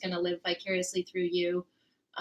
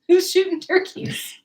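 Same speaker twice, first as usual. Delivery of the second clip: incredulous; 10 semitones higher, 10 dB louder than usual. Real, not acted. Incredulous question.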